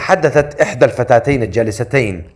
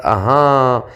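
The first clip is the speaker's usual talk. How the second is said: plain reading voice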